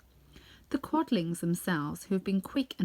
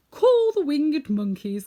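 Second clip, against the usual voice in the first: slow, louder Tin Woodman; 6 semitones higher, 9 dB louder, slower than usual. A narrator's reading. Tin Woodman advises Dorothy: slow loud counsel. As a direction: call it out slowly and firmly. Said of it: "counselled the Tin Woodman".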